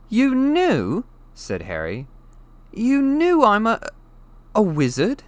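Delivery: high, loud disbelief; stunned boy questioning; shocked. A narrator's reading. Harry shocked.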